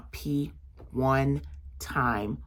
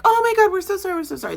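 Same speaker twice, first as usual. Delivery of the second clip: much higher, louder mock-gushing apology; apologetic parents; 14 semitones higher, 5 dB louder, much faster than usual. Parents apologizing to the vendor, high and gushing. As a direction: play the flustered parents, hands up.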